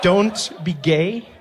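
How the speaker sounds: very slow, drawn-out; slow mock warning. Comic drawing out Ali's warning very slowly. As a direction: stretch every word.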